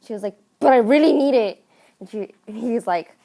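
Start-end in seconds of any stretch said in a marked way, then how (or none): 0.6-1.6 louder, higher mock whine; insistent pleading. Lizzie begs the druggist for poison, insistent. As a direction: press him like a child who won't give up.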